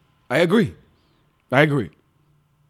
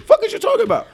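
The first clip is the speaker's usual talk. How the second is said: mocking reply; 12 semitones higher, faster than usual. Acting the other guy's reply.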